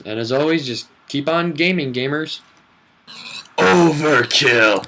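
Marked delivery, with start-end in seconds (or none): 3.6-4.9 slow booming villain shout. Pretend. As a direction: roar it out like a mad overlord. Villain voice announcing weapon kill.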